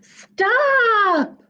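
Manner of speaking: high loud call; shout. Acted. Reader shouting for Puppy Truck to stop. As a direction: yell after the runaway truck. Calling after runaway truck.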